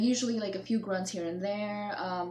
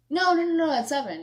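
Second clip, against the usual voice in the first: insistent no; 8 semitones higher, 7 dB louder than usual. Retold or imitated. Insisting to mom about kittens.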